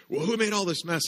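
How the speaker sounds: loud, high mock scolding; angry neighbor. Angry neighbor demanding who made the mess, loud mock scolding.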